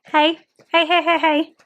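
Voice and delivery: loud, high repeated hey to get the dogs' attention; playful loud call at the pets; calling out to the dogs. Real, not acted.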